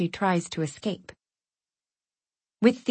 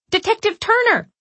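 high, loud shout; 13 semitones higher, 5 dB louder than usual. Acted. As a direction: shout for him down the hall. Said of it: "I shout again".

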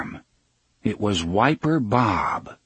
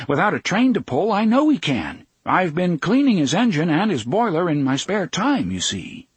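eager, higher-pitched insisting; insistent; 6 semitones higher than usual. A narrator's reading. Engineer argues for his old engine, insistent. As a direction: press the case, voice lifted. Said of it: "Engineer Bob insisted".

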